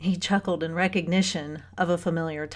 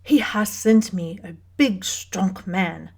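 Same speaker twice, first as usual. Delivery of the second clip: put-on German accent, each word carefully spaced; guttural German woman choosing her words; heavy German accent. A narrator's reading. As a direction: speak deliberately with a thick German accent. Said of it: "heavy German accent, the careful spacing of words".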